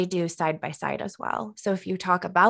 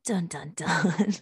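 dramatic whisper; 2 semitones lower, slower than usual. Pretend. Dramatic sting after the trailer read.

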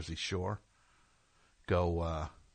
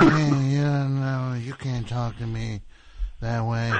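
mock bouncer; 4 dB louder, slower than usual.

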